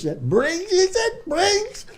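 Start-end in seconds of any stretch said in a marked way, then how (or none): 0.3-0.7 Briggs in a very high squeak. Retold or imitated. Name said again, squeaked. 0.7-1.9 very high, thin mock voice of a scared kid; imitating a nervous new recruit; squeaky frightened recruit.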